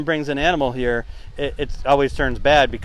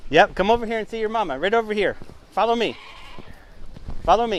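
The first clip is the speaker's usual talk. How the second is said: put-on soothing a child; 5 semitones higher, 3 dB quieter than usual. Guiding lamb to mother.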